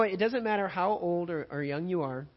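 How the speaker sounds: usual speaking voice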